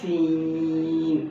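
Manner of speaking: سین very long with a hissing whistle, chanted; slow recited سین; whistled seen. Shown as a demonstration. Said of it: "دیکھیں آپ مجھے".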